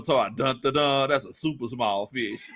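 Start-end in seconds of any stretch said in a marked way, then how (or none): 2.6-2.6 laughing disbelief. Real, not acted. Host reacting to a chat joke, high laughing disbelief. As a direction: yelp it in amused disbelief.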